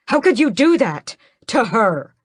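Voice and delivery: high, loud angry question; furious yell. Acted. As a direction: shout at his mother. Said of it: "I yell at her".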